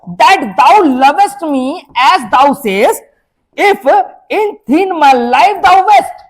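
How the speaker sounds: very slow, drawn-out declamation; slow, emphatic poetry reading; poem recitation. Acted. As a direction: recite verse word by word, stretching it out. Reads poem lines aloud.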